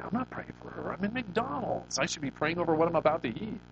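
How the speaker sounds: high, whiny mock complaint; a hammed-up grumble of refusal; reluctant inner protest. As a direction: argue back at the Spirit, put out. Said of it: "And I'm like".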